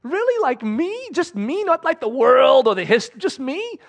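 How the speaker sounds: can't believe it's really about me; amazed disbelief. Retold or imitated. Preacher retelling his reaction to God's words, high astonished squeak. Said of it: "I was just like".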